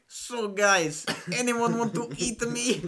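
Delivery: slow, lightly put-on Israeli accent with a laugh; imitating a friend's imitation of the actress; mock Israeli accent. Imitating a friend's Gal Gadot bit, in a lightly put-on Israeli accent. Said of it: "I love the accent he did".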